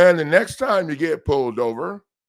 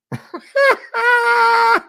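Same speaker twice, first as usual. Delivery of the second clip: a sudden, high-pitched burst of loud laughter; 8 dB louder than usual. Real, not acted. Loud laugh at expired card.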